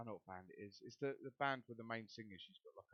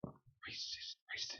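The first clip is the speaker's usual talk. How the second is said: background whisper; much slower than usual. Retold or imitated. Voices heard in background.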